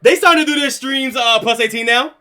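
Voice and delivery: ordinary talk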